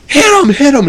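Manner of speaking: high, eager urging; egging Janet. Retold or imitated. Janet egging Jack to hit the buddy, high eager voice. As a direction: egg Jack on. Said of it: "Janet's like".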